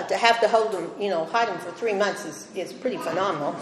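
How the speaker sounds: usual speaking voice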